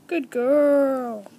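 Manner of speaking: loud, stretched praise for a pet; loud training-reward call; drawn-out praise. Real, not acted. Praising guinea pig during training.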